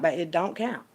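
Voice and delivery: normal talking voice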